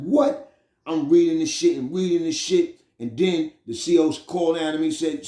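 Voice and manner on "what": sudden high shocked yelp; shocked what. Retold or imitated. Reading the ticket, stunned. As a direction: jolt up as if seeing a ghost. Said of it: "I'm like".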